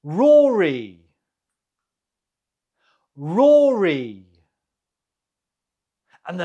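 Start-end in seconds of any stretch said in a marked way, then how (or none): 0.0-1.0 "Rory" as ROR-ee, loud first syllable; model pronunciation. Shown as a demonstration. Teacher gives the answer in pronunciation round, "Rory" said loudly as ROR-ee. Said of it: "how do you say this name?". 3.1-4.2 "Rory" pronounced ROR-ee, first syllable loud; model pronunciation. Shown as a demonstration. Teacher repeats the quiz answer for learners, "Rory" said loudly as ROR-ee. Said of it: "how do you say this name?".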